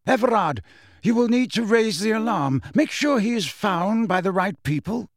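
high, commanding character voice; headmaster, urgent command. Acted. Dumbledore orders Everard to raise the alarm, urgently.

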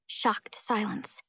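ordinary delivery, nothing marked

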